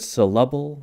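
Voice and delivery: "syllable" said as suh-LUH-bul, stress on the second syllable; accent the middle of "syllable" with an uh; "syllable" with a full, stressed uh in the middle; middle vowel UH. Shown as a demonstration. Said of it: "what vowel am I using".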